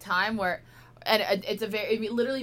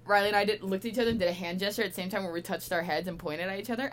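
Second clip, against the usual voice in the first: ordinary talk, nothing marked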